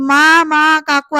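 loud mock call to mother and aunt. यामी आवाज देत entry घेते. (घरभर हाक मारल्यासारखी मोठ्याने हाक)

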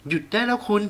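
high, loud sharp command; stern outburst. Acted. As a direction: cut him off hard. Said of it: "แม่หันไปพูดเสียงแข็งใส่พ่อ". (แม่พูดเสียงแข็งใส่พ่อให้หยุด)

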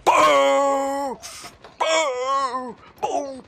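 high, loud, drawn-out "pum"; gleeful high bang noise; imitated gunshot boom. Real, not acted. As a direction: sing out each shot like a cartoon boom. Imitates shots while firing.

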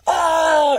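very high, loud mock scream; hammed-up child's shriek; overdramatic wail. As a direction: scream as if a grenade just dropped. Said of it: "just a full dramatic show".

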